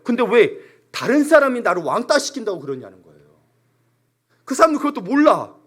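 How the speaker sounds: ordinary delivery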